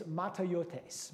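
"matayotes" sounded out as mah-tah-yo-tes; Greek pronunciation. Shown as a demonstration. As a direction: say "matayotes" as a careful Greek word. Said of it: "the way to pronounce this word is".

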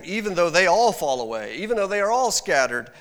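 loud, high, proud vow; boasting. Acted. Peter vowing loyalty to Jesus, a loud boastful declaration. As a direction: puff up and declare it loudly. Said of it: "He's boasting".

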